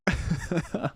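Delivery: high burst of giggles; real laughter. Real, not acted. Streamer amused by the synth's silly accent, high giggling. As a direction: giggle high, unable to hold it.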